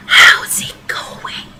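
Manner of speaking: hushed voiceless whisper. Acted. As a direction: breathe it as if not to disturb anyone. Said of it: "he whispers".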